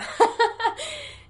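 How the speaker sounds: bright laugh high in the voice; sudden high nervous laugh; high teary laugh. Real, not acted. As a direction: laugh it off through tears. Laughing at her own tears.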